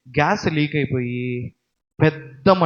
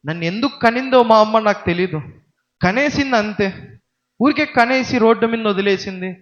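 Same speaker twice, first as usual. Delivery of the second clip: put-on raised, resentful boy cursing his mother; a boy loudly cursing his mother on the street, angry; angry rant; 6 semitones higher, 5 dB louder than usual. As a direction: spit out the grievances as a bitter son. Son ranting at his mother.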